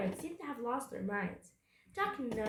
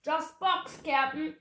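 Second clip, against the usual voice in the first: raised, loud call to the captain; shouted report; 6 semitones higher, 6 dB louder, slower than usual. A narrator's reading. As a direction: shout it back from a distance. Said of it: "shouted Stinky".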